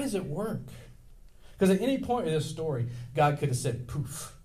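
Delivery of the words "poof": a soft breathy poof, barely voiced; whispered magic poof. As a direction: blow it away like a puff of smoke. God ending it in a puff.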